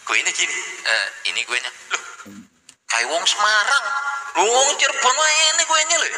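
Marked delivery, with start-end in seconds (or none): 1.9-6.1 spot the fake; mock surprise. Local spotting a Semarang cake, high surprised tone.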